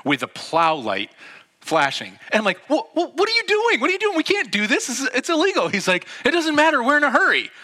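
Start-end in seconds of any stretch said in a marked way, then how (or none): 2.7-5.7 high, loud stammering disbelief; mock-frantic high-pitched outburst; alarmed protest. Acted alarmed protest to driver. 6.2-7.5 high, loud insistence; mock argument at raised pitch and volume; heated retort. Acted argument, driver shouts back.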